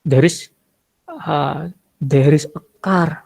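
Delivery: slow English in a thick Javanese accent. Shown as a demonstration. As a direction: lean into the medok Javanese twang. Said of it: "ada juga yang medok aksennya". (bahasa Inggris logat Jawa medok)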